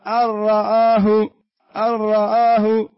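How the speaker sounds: أن رآه slow and loud, noon merged: "ar-ra'ah". Shown as a demonstration. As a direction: roll straight into the ra of أن رآه. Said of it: "هل نطقت أنا بنون الآن؟". (إدغام النون في الراء بصوت عالٍ وبطء)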